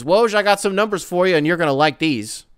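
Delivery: hammed-up angry TV host; heated host impression. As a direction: bark it like a pundit itching to fight. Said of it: "he's heated".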